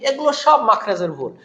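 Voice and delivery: ordinary talk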